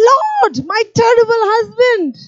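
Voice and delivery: hammed-up whiny high complaint. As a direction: list every grievance, exasperated. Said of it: "I can hear the Lord".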